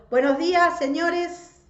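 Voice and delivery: high, loud call of greeting; greeting projected over a distance; hailing from afar. A narrator's reading. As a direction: call out cheerfully to people across the yard. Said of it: "desde lejos saludó".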